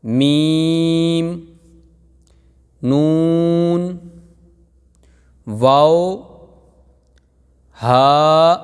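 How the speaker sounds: each letter drawn out and loud, like a teacher; slow letter recitation. Shown as a demonstration. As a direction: recite the alphabet slowly, clearly. Teacher recites letter names slowly. (حروف کی آہستہ بلند تلاوت)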